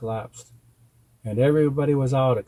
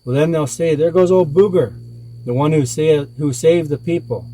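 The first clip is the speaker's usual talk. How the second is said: loud, mocking comeback; sarcastic shout; 4 semitones higher, 6 dB louder than usual. A narrator's reading. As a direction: shout it back with heavy sarcasm. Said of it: "very sarcastically shouted back".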